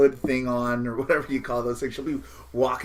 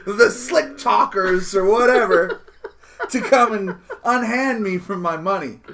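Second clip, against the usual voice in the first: loud, high laughing put-on voice; hammed-up tourist asking about gangs; mock nervous tourist; 7 semitones higher, 9 dB louder than usual. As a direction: play a scared tourist looking around.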